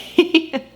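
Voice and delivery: short high laugh; high embarrassed giggle. Real, not acted. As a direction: giggle at the mess. Laugh at laundry pile.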